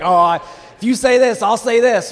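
loud, high-pitched imagined comeback; snappy, primed retort. Acted. Someone playing out an argument in their mind, snappy. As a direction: fire it off loudly, ready for a fight. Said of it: "She just kinda snaps on him".

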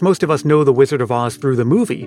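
ordinary delivery

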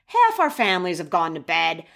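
normal talking voice

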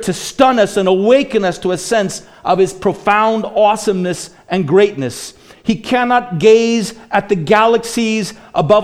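loud, high, slow preacher's voice, stretching each key word; thundering pulpit delivery; preaching at full force. Acted. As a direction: proclaim it to the congregation, big and slow. Raised preaching voice.

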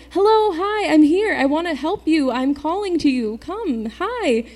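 high, sing-out mock calling; calling for attention. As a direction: call out to someone who isn't listening. God calling to distracted people, acted.

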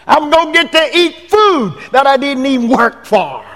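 preacher joking about heaven's rewards; gleeful joke. Acted. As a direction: relish the free food.

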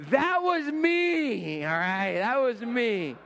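high, loud, stretched-out outburst; loud drawn-out exclamation. Real, not acted. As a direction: belt it out, dragging each word way up high. Joking outburst after the punchline.

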